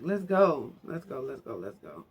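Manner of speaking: ordinary talk, nothing marked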